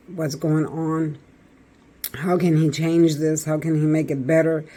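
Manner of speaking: ordinary talk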